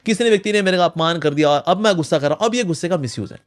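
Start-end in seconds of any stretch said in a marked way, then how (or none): none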